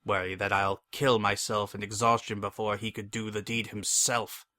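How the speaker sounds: ordinary delivery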